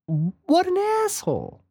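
high, slow disbelieving cry; incredulous exclamation. Real, not acted. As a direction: drag it out in disbelief. Exclaims about the director.

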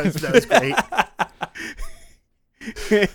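normal talking voice

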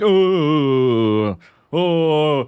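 indignant loud groaning oh; loud disapproving oh. Retold or imitated. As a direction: tut loudly at a line-jumper. Grumbling people in line.

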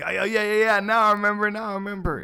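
high excited recall; sudden realization, high. Retold or imitated. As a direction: squeal out the realization. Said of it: "I was like".

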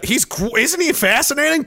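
ordinary talk, nothing marked

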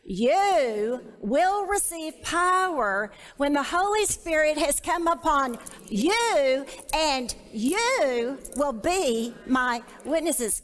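slow, weighty read with "You" stressed; emphatic reading. Shown as a demonstration. Leading the women in reading the verse emphatically. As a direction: read it out slowly, leaning on every "you". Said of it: "I want you to emphasize that word".

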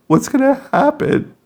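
high-pitched mock child worry; imitating worried child. Parent imitating son fearing for the babies, high and worried. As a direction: pitch it way up, like a fretful kid.

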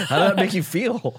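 amused and high-pitched; laughing question. Real, not acted. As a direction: ask it through laughter. Amused question after retold insult.

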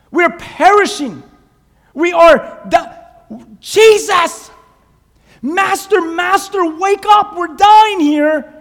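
high, loud, slow terrified cry; desperate panic. Acted. Disciples begging Jesus to wake up, loud desperate panic. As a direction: plead like the boat is sinking.

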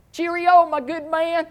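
high, loud put-on posh British farewell; mock British holler. Jogger hollers at the honking truck driver, mock British. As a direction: sing out a toff's British cheerio with a laugh. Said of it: "I hollered out".

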